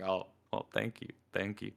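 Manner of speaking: soft retold reply, well under your voice; bashful thanks. Answering the ladies' compliment, quiet retold thanks.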